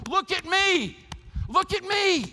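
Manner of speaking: loud, high-pitched full shout; shout across crowd. Acted. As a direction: shout over a crowd to grab everyone's attention. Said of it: "Peter shouts across the group and says".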